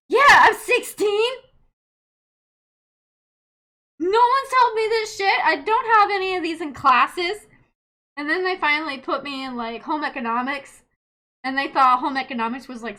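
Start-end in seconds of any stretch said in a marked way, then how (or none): 0.1-7.5 loud, high defensive protest; hammed-up outraged complaint, pitched up; indignant teen. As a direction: play a teenager arguing with parents. Acted quarrel with parents.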